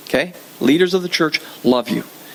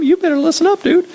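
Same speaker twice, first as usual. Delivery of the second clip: much higher and louder, a mother laying down the law; mom's stern order; 11 semitones higher, 3 dB louder than usual. Retold or imitated. Mother scolding her defiant son, high and loud. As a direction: mom snapping at the son who defied his sister. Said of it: "my mom said".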